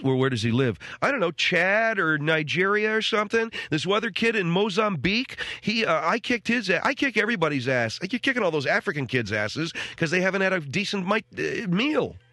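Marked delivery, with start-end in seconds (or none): 1.0-12.2 imitating Alex. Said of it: "everything's up at the end of a sentence with him".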